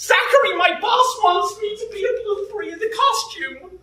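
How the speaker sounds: high-pitched, hammed-up American voice; squeaky American character voice; put-on American accent. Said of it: "Did you like my American accent?".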